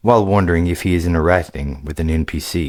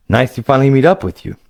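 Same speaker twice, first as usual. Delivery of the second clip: put-on lightly raised, eager young gamer voice; a casual young player, a bit higher than the narration; upbeat young adventurer, higher pitched; young hero Greylick; 8 semitones higher, 5 dB louder, faster than usual.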